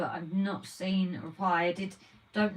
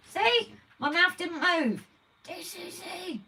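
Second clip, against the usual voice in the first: ventriloquist dummy voice; 9 semitones higher, slower than usual. Pretend. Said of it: "you're never gonna be Jeff Dunham".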